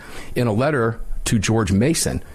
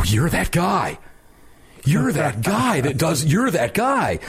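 excited recognition; 7 semitones higher, slower than usual. Retold or imitated. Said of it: "And I go".

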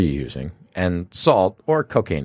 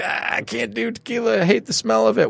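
whiny complaint; 11 semitones higher than usual. Retold or imitated. Whiny friend refusing tequila shots.

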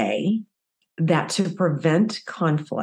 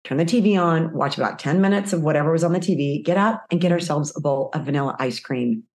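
usual speaking voice

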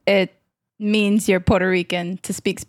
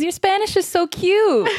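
cooing relative; 10 semitones higher than usual. Retold or imitated. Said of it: "looks at me and says".

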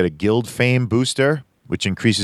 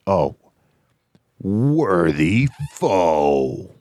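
very slow Viking proclamation; much slower than usual. Acted. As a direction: intone it like a Viking chieftain. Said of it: "with a Viking voice in mind".

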